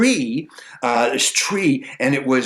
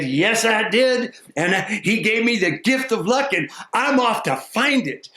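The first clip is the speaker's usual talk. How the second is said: higher, proud simple fellow; simple man; 9 semitones higher than usual. Acted. Simple man telling the maiden about his luck, lightly voiced. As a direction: boast a little about the gift.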